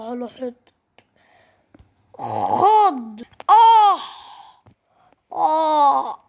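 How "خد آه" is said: sob, then a high, loud yell; mock beaten-kid wail; cry of pain. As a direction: get hit and wail. Kid struck by the bully.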